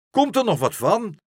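high, loud, impatient outburst; snorting with anger. A narrator's reading. Horse waiting to be hoisted, furiously impatient. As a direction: demand it loudly, fuming. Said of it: "brieste Langhors".

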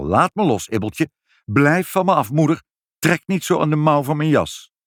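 mildly annoyed, rising insistence; irritated protest. Acted. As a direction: shake off the clinging family. Father fends off clinging family.

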